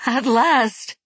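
high, loud tearful joy; ecstatic cry. Acted. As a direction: cry out in rapture. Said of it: "wept Yori".

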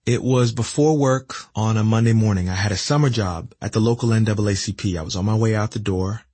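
plain reading voice

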